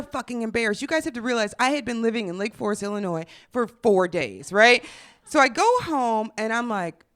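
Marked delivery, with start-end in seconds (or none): none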